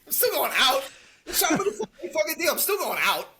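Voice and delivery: high, loud, laughing through it; laughing mock tough guy; laughing Tommy quote. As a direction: quote him while cracking up.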